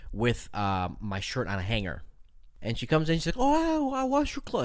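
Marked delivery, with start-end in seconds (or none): 3.4-4.6 high, polite nanny voice. Retold or imitated. Imitating the nanny handing over his shirt, high and polite. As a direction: lift it high and sweet, very polite. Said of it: "Like, very nice, like, very polite".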